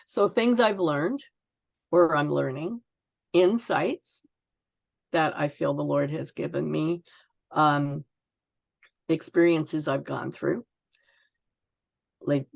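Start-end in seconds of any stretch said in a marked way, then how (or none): none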